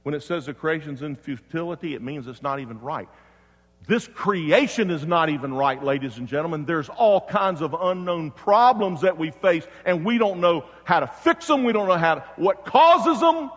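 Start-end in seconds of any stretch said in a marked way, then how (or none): 3.9-13.5 high, loud pulpit voice; raised, driving sermon delivery; full-force preaching. Real, not acted. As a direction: preach it out to the whole congregation.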